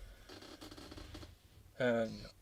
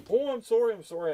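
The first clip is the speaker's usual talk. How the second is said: high, louder whiny sorry, mocking the trucker; mock whiny apology; 9 semitones higher, 6 dB louder than usual. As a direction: ham up his pitiful apology.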